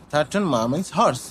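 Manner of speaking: normal talking voice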